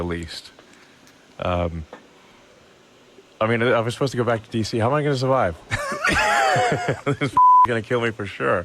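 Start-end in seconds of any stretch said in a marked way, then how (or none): 4.8-7.4 laughing. Real, not acted. Laughing at his own fear.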